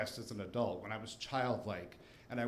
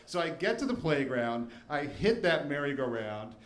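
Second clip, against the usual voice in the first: ordinary talk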